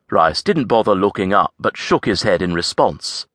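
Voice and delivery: usual speaking voice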